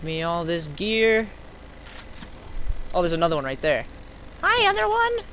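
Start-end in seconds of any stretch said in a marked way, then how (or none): 4.4-5.2 very high, cheery hello; high squeaky greeting. Pretend.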